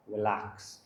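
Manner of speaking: low, soft soothing relax; calm relax. Shown as a demonstration. Trainer soothing a nervous dog, low and soft. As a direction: settle the dog with a soft word. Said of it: "in a lot of videos you will see with me".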